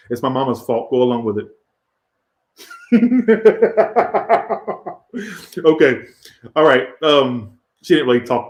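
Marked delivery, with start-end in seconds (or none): 2.7-5.5 long, high cackling laugh; real long laugh. Real, not acted. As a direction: burst out laughing and keep going. Laughs at own excuse.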